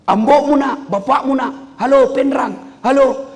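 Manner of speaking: loud, high hallo shouts; high, loud phone yelling. Retold or imitated. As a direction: holler down the line like an old man who has never used a phone.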